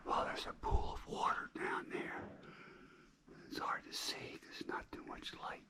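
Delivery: hushed cave whisper. Real, not acted. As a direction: keep it to a whisper, in awe. Whispering through the cave tour.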